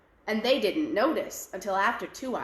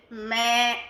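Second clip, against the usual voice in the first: goat bleat; 5 dB louder than usual. Acted.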